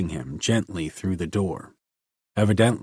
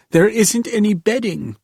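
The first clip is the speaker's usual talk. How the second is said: despairing cry; 14 semitones higher, 6 dB louder than usual. A narrator's reading. Girl in despair.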